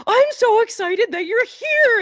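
a fan overjoyed to meet his hero; gushing fan. Retold or imitated. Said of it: "this guy comes running up and he's like".

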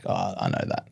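low, soft, offhand "Oh, I know that"; dismissive shrug. Retold or imitated. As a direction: toss it off with a shrug. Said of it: "bit of a shrug".

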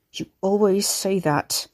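slow, bitter hiss; drawn-out hissed retort. A narrator's reading. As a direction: hiss the resentment out slowly. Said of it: "he hissed down his chains".

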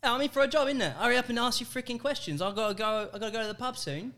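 put-on high, cheeky British accent. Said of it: "British, English, general U K".

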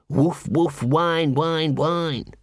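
spoken woof woof and whines; a hint of a whimpering dog; mock dog noises. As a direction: play the little dog, lightly. Angua voicing a little dog.

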